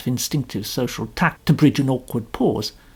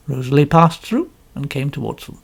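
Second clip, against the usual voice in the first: usual speaking voice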